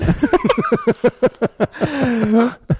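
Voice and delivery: big laugh. Real, not acted. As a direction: laugh hard at the punchline. Laughs at own punchline.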